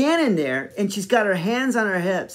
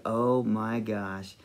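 low, soft, drawn-out awe; re-enacted dazed amazement; stunned; 7 semitones lower, 7 dB quieter, much slower than usual. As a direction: breathe it out slowly in quiet wonder. Recalling being stunned by kiss.

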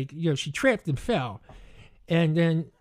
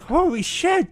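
higher-pitched shocked exclamation; retold shock; 9 semitones higher, 4 dB louder than usual. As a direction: act out your startled reaction. Reacting to headboard hitting her.

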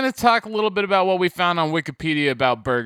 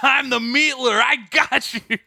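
higher, gloating villain voice; 4 semitones higher than usual. Pretend. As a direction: taunt like a cartoon villain. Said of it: "laughing at you ... going".

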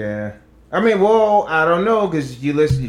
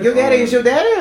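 mock disbelief; 12 semitones higher, 7 dB louder than usual. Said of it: "I'm like".

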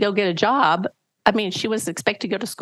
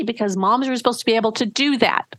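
ordinary delivery

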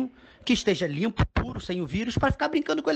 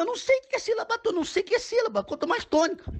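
much higher-pitched, exasperated outburst; exasperated protest; 11 semitones higher than usual. Acted. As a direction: protest ignorance in a high voice. Dad exasperated by homework question. (protesto exasperado, com a voz bem aguda)

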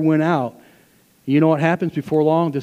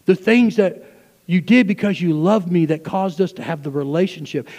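full-force preaching; 5 semitones higher, 5 dB louder than usual. Acted. Pastor voicing Jesus to the church, loud preaching. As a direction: preach the rebuke with weight.

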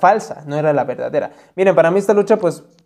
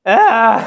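sneeze; 5 semitones higher, 3 dB louder than usual. Real, not acted. Sneeze after warning.

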